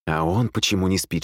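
plain reading voice

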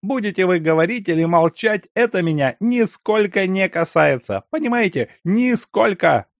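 sharp angry voice. A narrator's reading. Continues sharp retort.

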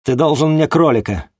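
loud, high, indignant huff; huffy snort. Acted. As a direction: snort it at him. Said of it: "фыркнула она".

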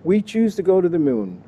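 your own Canadian accent, jokingly; lightly voiced Canadian accent. Shown as a demonstration. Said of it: "My Canadian accent, however, is really good".

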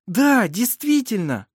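high, loud, drawn-out delight; elated exclamation. A narrator's reading. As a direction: confirm the good news with feeling. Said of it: "воскликнула Элизабет".

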